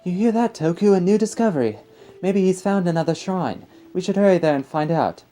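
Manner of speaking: high voiced British villager, excited; excited character in a British accent. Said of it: "apologies for my British accent".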